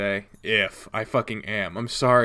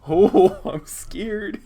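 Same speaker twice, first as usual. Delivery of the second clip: mock scared; 8 semitones higher, 5 dB louder, much slower than usual. Mock fear after laughing.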